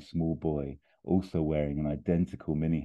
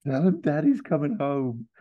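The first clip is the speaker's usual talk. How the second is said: high, loud, hammed-up tearful father; Mustafa; 10 semitones higher, 5 dB louder than usual. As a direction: promise it high through mock tears. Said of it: "tears in his eyes".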